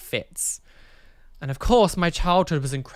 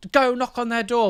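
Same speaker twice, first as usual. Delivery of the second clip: high imitation of a frantic command; retold urgent order; 8 semitones higher, 4 dB louder than usual. Mum sending children to the neighbour's, retold urgent cry. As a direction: mimic a panicked mother giving orders.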